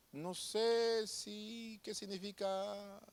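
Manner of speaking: acted sheepish uncertainty; hesitant, unsure answer. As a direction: trail along timidly, stretching each word.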